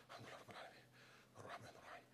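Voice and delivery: breathy, silent recitation under the breath; whispered prayer. Shown as a demonstration. Preacher showing how to pray calmly, whispering. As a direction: murmur it as if praying alone. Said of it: "صلي ركعتين".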